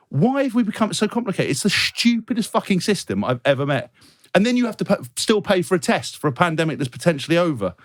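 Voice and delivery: worked-up comic outrage; exasperated rant. Real, not acted. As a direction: rail against it in indignant disbelief. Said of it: "[name]'s rant".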